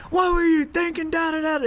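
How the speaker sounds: high and loud scolding; angry coach yell. Retold or imitated. Coach chewing out his athlete in front of bleachers.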